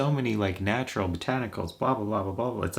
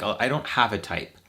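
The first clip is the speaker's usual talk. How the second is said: usual speaking voice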